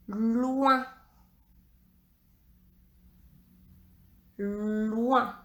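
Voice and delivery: "loin" with the opening l held long; slow, careful "loin", l prolonged; drawn-out dictation. Shown as a demonstration. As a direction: stretch the first sound of "loin" for pupils. Dictating a syllable for pupils.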